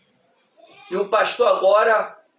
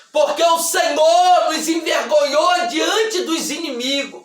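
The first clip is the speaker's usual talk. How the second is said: raised, sustained preacher's voice; forceful preaching; 4 semitones higher than usual. Acted. Preacher warns the congregation about shame before enemies, forceful preaching.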